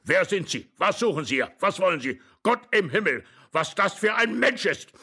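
worked-up volley of questions; agitated old man. A narrator's reading. Old man confronts the intruder.

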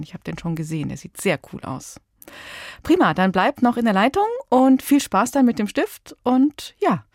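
ordinary delivery

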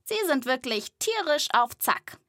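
ordinary delivery

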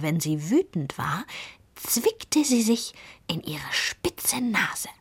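usual speaking voice

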